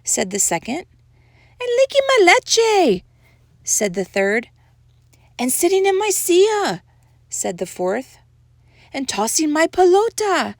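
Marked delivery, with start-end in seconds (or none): 1.6-3.0 very high, loud little doggy; small amigo outrage. A narrator's reading. Third amigo complains in story. 5.4-6.8 high little doggy voice; small amigo complaint. A narrator's reading. Fourth amigo complains in story. 8.9-10.6 high little doggy voice; small amigo complaint. A narrator's reading. Fifth amigo complains in story.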